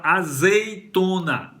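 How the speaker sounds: azeitona drawn out in syllables; slow articulation. Shown as a demonstration. Teacher showing the Z inside azeitona, slowly articulated. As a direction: stretch azeitona slowly, sounding the Z. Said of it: "a pronúncia do Z e a entonação do Z".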